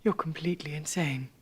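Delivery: cold, hushed whisper. Acted. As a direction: whisper it with icy contempt. Wife confronts husband's young lover.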